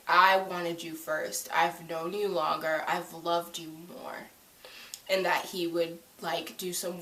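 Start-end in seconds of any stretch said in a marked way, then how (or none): 0.1-4.3 slow, soft heartfelt plea; imagined confession fading quieter; tender confession. Pretend. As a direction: plead softly and slowly. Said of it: "Alec would be like".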